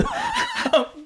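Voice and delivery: squealing high laugh; real shrieking giggle; high burst of laughter. Real, not acted. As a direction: laugh in disbelief at the memory. Laughs after quote.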